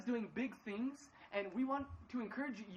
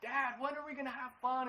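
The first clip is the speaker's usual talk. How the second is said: put-on higher, louder pleading kid voice; whiny son; 5 semitones higher, 5 dB louder than usual. Dad imitating his son begging for fun, higher and louder. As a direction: beg like a bored boy wanting fun. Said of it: "poor thing, is going".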